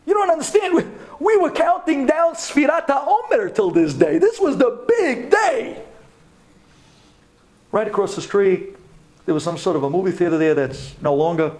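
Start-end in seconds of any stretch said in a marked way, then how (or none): 0.1-5.8 excited plea. Real, not acted. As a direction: make them feel how huge the day was. Excited plea about big day.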